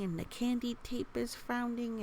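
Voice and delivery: ordinary delivery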